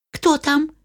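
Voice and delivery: high little goats asking; kid goats voice. Acted. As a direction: squeak out the question. Kids ask.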